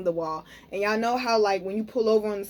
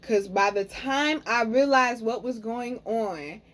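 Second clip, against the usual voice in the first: ordinary talk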